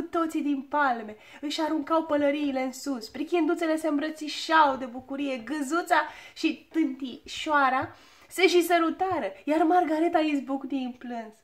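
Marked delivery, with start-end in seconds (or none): none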